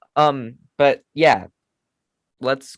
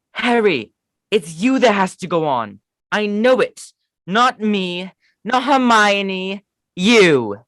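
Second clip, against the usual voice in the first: higher, drawn-out not Hermione; Ron impression; 7 semitones higher, 4 dB louder, slower than usual. Ron telling Harry he must go, higher pleading. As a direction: plead with Harry, slow at the end. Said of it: "I do not have that good of a British accent".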